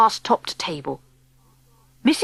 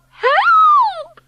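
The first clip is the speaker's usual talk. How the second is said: very high, loud scream; 6 dB louder than usual. A narrator's reading. Wife terrified of the mouse, piercing scream. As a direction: shriek for rescue in terror. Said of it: "screamed Missus Jenkins".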